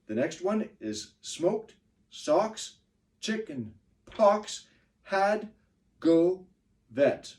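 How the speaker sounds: slow stressed words, pox high; slow beat reading of stressed words. Shown as a demonstration. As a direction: land each stressed word on the beat. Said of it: "just like we were clapping before".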